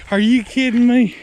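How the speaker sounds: can't believe his eyes; amazed disbelief. Real, not acted. Seeing the giant buck with his partner, loud stunned disbelief.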